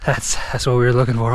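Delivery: normal talking voice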